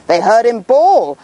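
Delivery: plain reading voice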